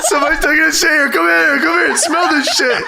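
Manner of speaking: high, hurried mock yell; imitating yelling to everyone. Co-host acting out yelling out the bathroom door, mock yell. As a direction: summon a crowd with gleeful urgency. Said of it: "opens the door and yells at everybody".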